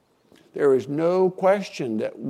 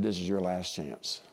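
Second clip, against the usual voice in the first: quiet inner voice; 8 semitones lower, 10 dB quieter than usual. Retold or imitated. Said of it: "he spoke to my heart and he said".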